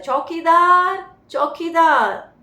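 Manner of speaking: a loud, slow call of चौकीदार in a Bengali Indian accent. Shown as a demonstration. Teacher shows how a Bengali Indian calls the watchman, Bengali accent. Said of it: "जैसे Bengali Indian क्या बोलता है".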